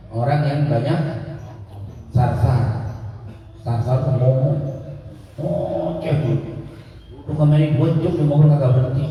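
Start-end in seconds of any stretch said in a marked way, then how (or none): 5.4-9.1 quick, teasing mimic of a chatty friend; lightly imitated gossiping buddy; mock chatterbox. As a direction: rattle it off like you're ribbing a mate. Mocking a nonstop chatterbox friend.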